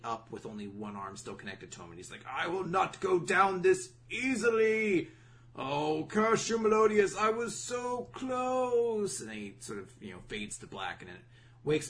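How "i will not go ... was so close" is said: high, loud, slow hammed-up villain cry; over-the-top mock villain howl; dying villain rant. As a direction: ham up a defeated villain's curse.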